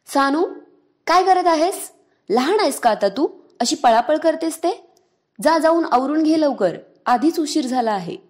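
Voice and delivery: a mother yelling at a grown daughter to stop playing; mother scolding. Mother scolding her daughter for running around, loud and shrill. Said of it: "तिला ओरडली".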